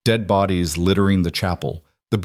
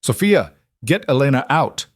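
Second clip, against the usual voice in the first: urgent shout; 6 semitones higher than usual. A narrator's reading. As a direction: bark the order. Ordering escape under fire.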